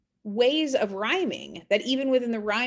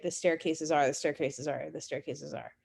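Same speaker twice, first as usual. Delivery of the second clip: the staircases are said three times, each softer and fading away; repetition fading; 5 semitones lower, 5 dB quieter than usual. Shown as a demonstration. Teacher showing repetition, fading each repeat. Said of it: "repetition, right?".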